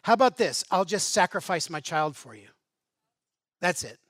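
loud bitter outburst, then flatter; bitter contempt. Acted. People bitterly offering their child to God. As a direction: throw it down as a final insult. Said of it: "can you hear the contempt in their voice".

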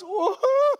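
high, loud whoop with a laugh; whooping son. Retold or imitated. Son whooping as he speeds past his dad, laughing. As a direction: whoop like a caught teen making a getaway. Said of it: "And he goes".

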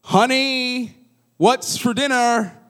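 called out high and loud across the house; calling out. Retold or imitated. Husband calls out louder.

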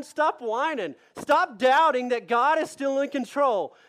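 normal talking voice

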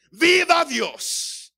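high, drawn-out shout of acclamation; shouted praise. Shown as a demonstration. Preacher showing the congregation how to shout to God, shouted praise. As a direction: cry it out long and high.

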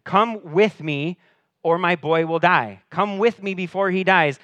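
desperate father demanding Jesus come; urgent demand. Retold or imitated. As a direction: insist like time is running out. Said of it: "the official demanded".

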